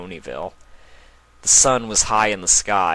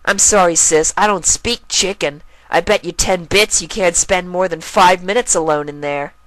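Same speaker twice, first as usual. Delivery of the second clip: higher colt voice, louder, daring; a brother throwing down a bet; cocky colt; 11 semitones higher, 6 dB louder than usual. Acted. Colt daring his sister to enter the forest, higher and smug.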